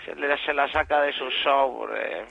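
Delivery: low, soft seductive murmur; voz seductora. Acted. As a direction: seduce the listener. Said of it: "Es un asco".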